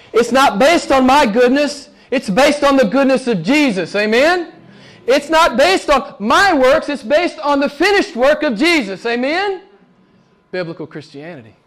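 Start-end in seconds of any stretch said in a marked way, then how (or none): 0.1-9.6 higher, driving sermon delivery; impassioned preaching. Real, not acted. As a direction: preach it, lifting toward each amen. Preacher drives the point home.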